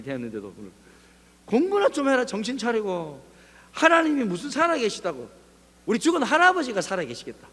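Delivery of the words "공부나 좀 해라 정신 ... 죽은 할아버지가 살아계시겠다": raised, scoffing taunt; mocking friend. Classmate telling the believer to study, mocking scorn. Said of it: "하나님을 막 조롱하는 그 소리에".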